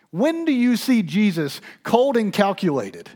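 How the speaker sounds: high, loud, driving sermon climax; full-force preaching. Acted. Pastor preaching to congregation, loud passionate build.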